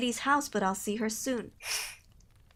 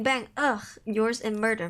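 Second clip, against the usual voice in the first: ordinary talk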